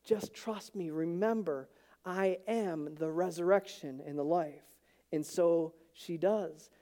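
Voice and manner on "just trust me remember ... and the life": gently calm her worry; quiet and sure; calm reassurance. Retold or imitated. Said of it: "he's like".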